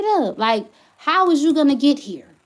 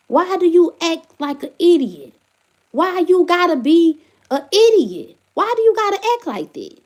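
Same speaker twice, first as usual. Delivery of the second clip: higher and louder, fed-up questions; exasperated scolding; 5 semitones higher, 4 dB louder than usual. Real, not acted. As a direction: scold him like he's in the room. Venting at the kids' father.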